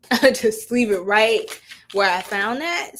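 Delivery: ordinary talk, nothing marked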